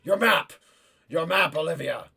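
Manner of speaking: loud urgent demand; urgent shout. Acted. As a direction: shout over the gallop. Lord demands map while riding.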